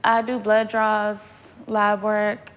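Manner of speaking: ordinary talk, nothing marked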